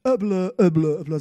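loud babbled "öble" noises; loud imitated grumbling, repeated; mock blah-blah. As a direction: babble over the complaints dismissively. Mocks Gideon's stream of complaints.

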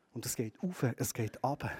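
quiet tender echo of the ladder; soft echo. Acted. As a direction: let "auf" rise and "ab" fall, almost hushed. Ladder image repeated softly.

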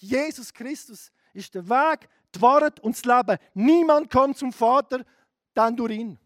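loud, insistent pulpit voice; full-force sermon proclamation; fiery preaching. Acted. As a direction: hammer the point home.

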